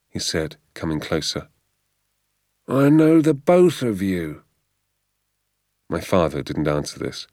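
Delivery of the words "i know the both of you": high, slow, threatening drawl; menacing keeper. Acted. Gamekeeper threatening the poachers, slow menacing voice. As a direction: loom over them, every word a threat.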